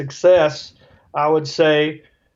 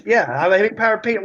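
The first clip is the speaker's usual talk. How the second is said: fast sarcastic reply; 4 semitones higher, faster than usual. Retold or imitated. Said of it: "Or if I'm in a bad mood".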